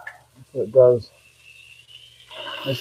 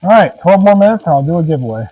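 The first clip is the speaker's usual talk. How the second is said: loud announcement; 5 semitones higher, 9 dB louder than usual. Real, not acted.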